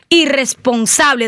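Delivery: ordinary talk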